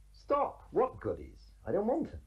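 high-pitched mock refusal; mock protest. Borrower refusing the bankers' money, mock protest.

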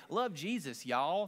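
loud, folksy Southern drawl; Southern accent. Shown as a demonstration. Preacher mocking how unconvincing he sounds, Southern accent. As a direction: ham up your Southern twang. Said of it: "Southern, you drop about fifteen".

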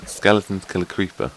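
ordinary talk, nothing marked

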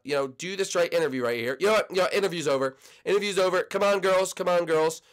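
normal talking voice

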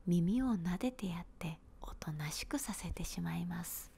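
plain reading voice

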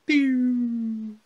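high swooping pew; falling sound effect. Acted. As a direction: make a cartoon drop noise. Sound effect of world dropping.

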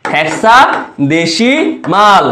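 Hexadecimal stretched very slowly; slowly drawn out. Shown as a demonstration. Tutor to students, spelling out hexadecimal slowly. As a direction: draw out every syllable of hexadecimal. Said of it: "যেটা উচ্চারণ করো তোমরা".

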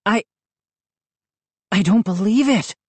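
halting, stunned disbelief; stunned murmur. A narrator's reading. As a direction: stammer it with a long pause, pitch lifting. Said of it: "I murmured".

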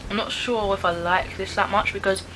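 usual speaking voice

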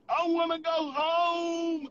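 slow, stretched-out call of I wanna go home; drawn-out yell. Retold or imitated. Avo in the back seat begging to go home, slow drawn-out yell.

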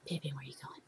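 whispered question to the ferret. Real, not acted. Owner whispering to her ferret wandering off, hushed. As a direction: breathe it without voice.

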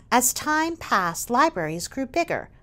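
ordinary delivery, nothing marked

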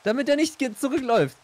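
hoch, laut und ungeduldig; genervtes Rufen. Real, not acted. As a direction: schimpf ihn laut zurecht. Genervt, Partner baut falsch. (genervt rufend)

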